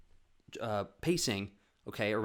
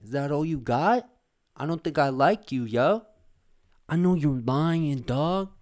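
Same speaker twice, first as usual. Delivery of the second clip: loud, higher threatening taunt; menacing mugger; 7 semitones higher, 10 dB louder than usual. Retold or imitated. Intimidator threatening kids at their table, loud taunting. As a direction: lean in and bully them loudly. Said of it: "They were like".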